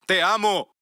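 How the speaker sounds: high, loud cry of love; a lover's public shout. Acted. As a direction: shout it so she can't ignore him. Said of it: "seguiré gritando y diciendo que te amo".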